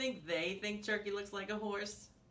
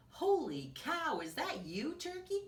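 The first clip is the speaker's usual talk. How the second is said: surprised pig; 8 semitones higher than usual. A narrator's reading. Pig spotting Turkey in disguise, high mock surprise. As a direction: exclaim in mock amazement, swooping up.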